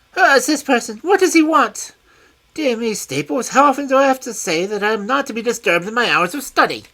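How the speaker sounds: high, loud, fussy complaint; a peevish scholar snapping at his butler; high piercing petulant man. A narrator's reading. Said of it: "I heard a high, petulant, penetrating voice".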